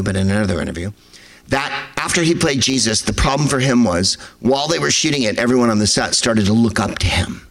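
ordinary talk, nothing marked

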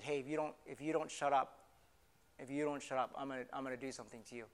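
hushed, low menace; low threat. Acted. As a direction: warn him off under your voice. Last man threatens him.

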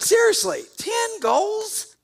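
can you believe this; incredulous shout. Real, not acted. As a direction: shout it high in disbelief. Mock disbelief at hockey score.

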